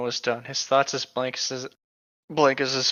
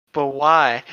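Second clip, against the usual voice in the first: imitating Emmett; 5 dB louder than usual. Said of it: "that's his only response".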